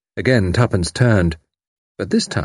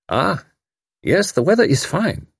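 polite foreign gentleman; 9 semitones higher than usual. A narrator's reading. German refugee replies politely.

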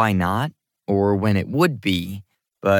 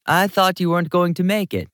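higher-voiced woman with a slight Jamaican accent; 9 semitones higher than usual. A narrator's reading. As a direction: greet them with a light Jamaican lilt. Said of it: "said in a slight Jamaican accent".

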